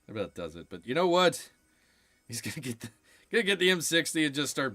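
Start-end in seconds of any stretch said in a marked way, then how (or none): 0.1-0.7 that about does it muttered low and soft; quiet echo. Shown as a demonstration. Echoing his own line to himself, low and soft. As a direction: drop it to an aside.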